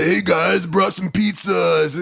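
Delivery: sing-song mock cartoon greeting, drawn out at the end; auto voice. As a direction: call it out in a put-on, half-sung voice. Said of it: "doing the fucking auto voice".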